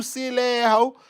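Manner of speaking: ordinary talk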